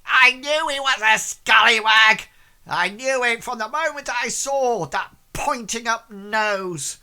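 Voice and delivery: put-on high, loud accusing old woman. Old woman in the crowd condemns Clarence, loud high accusation. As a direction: crow it like a scandalised old woman. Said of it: "an old woman sort of, like, says".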